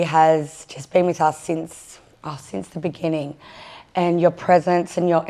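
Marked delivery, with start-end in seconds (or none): none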